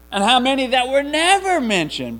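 normal talking voice

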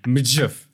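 "Mjf" squashed into one vowelless syllable; letters as one word. Shown as a demonstration. Co-host insists on the joke pronunciation, "Mjf" as one syllable. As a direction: pronounce "Mjf" as a word, not spelled out. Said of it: "That's pronounced mjf".

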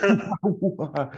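sudden high, loud yelp; high pained yelp. Acted. Chevy burns his fingers on the match, yelping. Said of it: "and like that".

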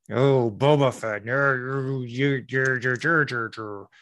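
drawn-out garble through clenched teeth; mock a villain who can't open his mouth; gritted-teeth Cad Bane. Fan mocking an actor's prosthetic, gritted teeth. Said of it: "gritting through his teeth".

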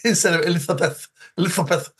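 Elizabeth said as "Elithabeth" with a lisp. Shown as a demonstration. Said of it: "practicing how to say Elizabeth".

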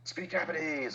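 high mock drunk bark; drunk man. Friends mocking a drunk man, high drunken order. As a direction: mimic a drunk demanding. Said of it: "drunk Japanese man was like".

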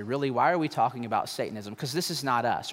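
low, quiet doubter's voice; eye-rolling skepticism. Acted. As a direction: grumble it softly like an unconvinced listener. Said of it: "roll your eyes and say".

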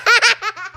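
high, acted fit of giggles; giggling her head off. Missus Gigglesworth laying down winning cards, gleeful giggling. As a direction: giggle helplessly in triumph. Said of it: "started giggling her head off".

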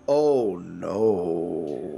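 long, low drawn-out dread; sinking dismay. Acted. Bookkeeper finds trouble in his book, sinking dismay. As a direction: let it slide down low as the bad news lands. Bookkeeper discovers something alarming.

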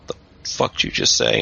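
not angry, just thrown by it; stunned disbelief. Acted. Gangster blindsided by talk of his mom, stunned. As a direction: caught off guard, pitch jumping up. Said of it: "but in a, like, "I wasn't expecting somebody".

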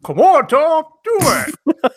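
high, loud puppet squawk; sock puppet voice. Pretend. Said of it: "give your sock puppet a, a weird accent".

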